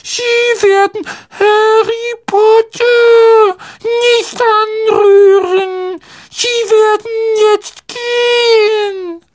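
very high, slow, loud elf voice; squeaky indignant order, every word drawn out; empörter Hauself. A narrator's reading. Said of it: "sagte er empört". (empört, schrill und gedehnt)

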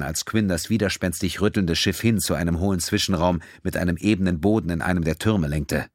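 ordinary delivery

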